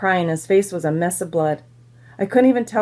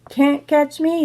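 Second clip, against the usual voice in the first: put-on higher, playful toddler holler; hollered taunt; 8 semitones higher, slower than usual. A narrator's reading. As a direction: call it out like a teasing toddler. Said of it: "he hollered".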